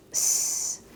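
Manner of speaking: a soft hissed "S" with no buzz; slow, voiceless hiss for the letter "S"; hissed S sound. Shown as a demonstration. Teacher showing the Spanish z sound, a soft hiss. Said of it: "in Spanish, it's just like a S".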